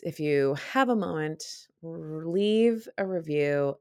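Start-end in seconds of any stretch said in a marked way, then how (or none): none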